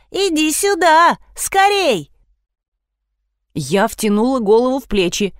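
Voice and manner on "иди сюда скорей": high-pitched, drawn-out summons; yelling girl. A narrator's reading. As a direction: call them over urgently in a high voice. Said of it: "завопила Лиза". (вопит высоким голосом)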